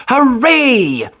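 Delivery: bright, lifted hooray; cheering. A narrator's reading. Narrator cheering the tortoise rescue, bright. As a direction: celebrate.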